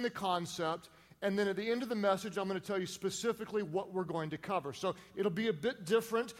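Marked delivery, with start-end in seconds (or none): none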